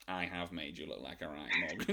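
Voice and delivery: dopey mimic of a contestant; imitating his silly voice; stupid voice. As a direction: sound a bit dim, mocking him. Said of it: "his voice was so stupid".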